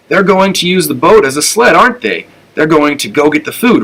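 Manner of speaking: put-on bursting to know, a young dog's hope; eager excitement. A narrator's reading. Flora pestering Oscar about the sled, eager and excited.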